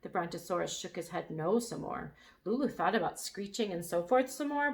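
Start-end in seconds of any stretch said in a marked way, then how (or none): none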